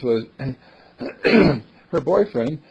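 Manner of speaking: usual speaking voice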